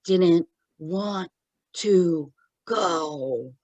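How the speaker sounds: very slow, halting spirit voice; imitated dying gasps; gasping for breath. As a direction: labour out each word with gaps, as if breathless. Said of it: "gasping for breath".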